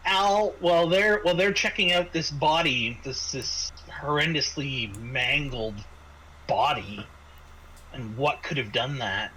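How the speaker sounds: ordinary talk, nothing marked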